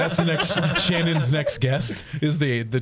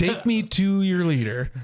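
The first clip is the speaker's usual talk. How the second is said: slow, higher-pitched mock alien; 6 semitones higher, slower than usual. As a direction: draw it out as a visiting alien. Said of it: "imagine aliens speaking like that".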